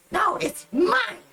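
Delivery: put-on high, slow shrieking goblin girl. Goblin girl fighting the minotaur over the satchel, high shriek. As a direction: squeal it like a greedy little goblin.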